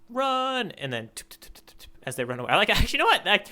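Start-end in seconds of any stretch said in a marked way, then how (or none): 1.3-1.9 whispered pitter-patter of little feet; breathy tiptoe sound effect, rapid; running footsteps onomatopoeia. Shown as a demonstration. Host voicing the fleeing characters' footsteps, whispered. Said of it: "force myself to do the onomatopoeia".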